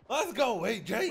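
hype shout. Real, not acted. Hyping a gifted sub.

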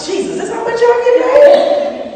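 high, loud, drawn-out disbelief; astonished. Retold or imitated. Actress discovering her pay, astonished high holler. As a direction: squeal it in mock shock.

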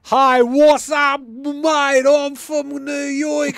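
put-on loud, high, drawn-out New York accent; brash cartoon New York accent, vowels stretched. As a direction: belt out a hammed-up New Yorker. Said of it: "your accent".

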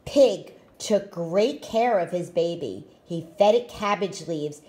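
ordinary delivery, nothing marked

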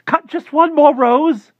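very high and loud, urgent begging; frantic high plea. Acted. Klausner begging his neighbor to cut a rose, frantic shout. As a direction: plead frantically at the top of the voice. Klausner pleads over the fence.

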